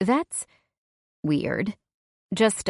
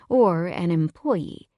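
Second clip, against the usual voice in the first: ordinary delivery, nothing marked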